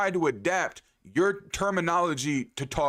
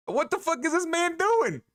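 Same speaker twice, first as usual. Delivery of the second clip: stunned disbelief; 13 semitones higher than usual. Retold or imitated. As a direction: shriek it in total disbelief. Imagined outsider shocked at him.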